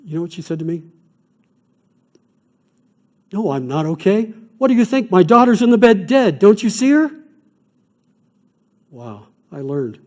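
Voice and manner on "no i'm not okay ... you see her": high, loud, furious outburst; angry retort. Retold or imitated. Grieving mother to the chaplain, furious high shout. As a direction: throw the question back in disbelief.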